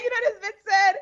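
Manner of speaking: high, laughing gossip quote; imitating a friend spilling gossip, laughing; laughing mimic of friend. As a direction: squeal it through laughter.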